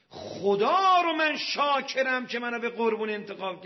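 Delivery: high, loud cry of thanks; fervent gratitude. Acted. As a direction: proclaim thanks to God at full voice. Acted son thanks God for choice. (شکرگزاری با فریاد پرشور)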